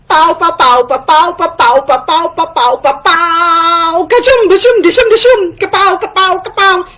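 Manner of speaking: storyteller's animated battle noises; fight sound effects. A narrator's reading. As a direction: punch out each mock blow with the voice.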